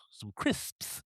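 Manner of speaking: hushed hint of British; British affectation. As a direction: slip into a soft mock-British word. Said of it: "you've suddenly gone British".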